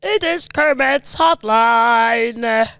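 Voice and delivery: slow, stretched puppet frog voice; Kermit the Frog. Acted. Frog puppet opening the hotline, slow and stretched. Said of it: "Kermit the Frog".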